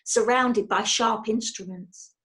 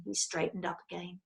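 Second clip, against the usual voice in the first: plain reading voice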